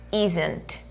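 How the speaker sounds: isn't said clearly as IZ-ent; isn't spoken slowly with a clear final t; pronunciation model. Shown as a demonstration. Teacher drilling the pronunciation of isn't. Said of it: "vamos cuidar da pronúncia".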